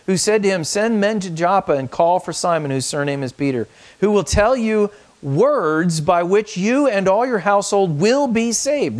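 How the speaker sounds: scripture reading. Acted. Pastor reading scripture aloud to the congregation, proclaiming. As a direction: read the verse out as a proclamation.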